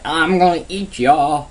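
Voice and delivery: hammed-up monster threat; troll. Troll threatening the little goat, hammed-up growl. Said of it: "a troll comes up, like".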